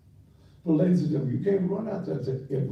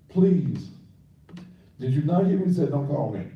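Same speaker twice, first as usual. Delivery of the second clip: louder, exasperated rebuke to a caller; real annoyance, raised voice; irritated scolding; 3 semitones higher, 4 dB louder, faster than usual. Real, not acted. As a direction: scold the caller who won't listen. Scolding an unwanted caller on air.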